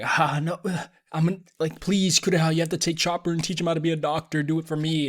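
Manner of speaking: mock plea to the doctor, pushed up in pitch; pleading dying father. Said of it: "He's like".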